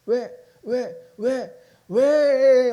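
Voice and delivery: drawn-out whining why. Acted. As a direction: stretch each why like a frustrated child. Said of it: "막 이럴 때 많잖아".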